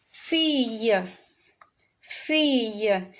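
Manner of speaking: Fille sounded fee-yuh, the double L as y; French fille. Shown as a demonstration. Teacher repeating Fille for the class, clear y sound. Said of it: "E sauti ya Y".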